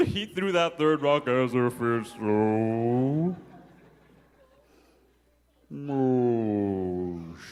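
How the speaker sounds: drawn out, dropping slow-motion voice. Pretend. Narrator showing the throw in slow motion, drawn out. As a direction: stretch every word like a slowed replay. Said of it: "let's see that in instant replay".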